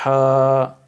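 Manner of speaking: ہا from mid-throat, loud; throaty ha. Shown as a demonstration. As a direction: breathe ہا from the middle of the throat. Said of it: "وسط حلق سے ادا کرنا ہے".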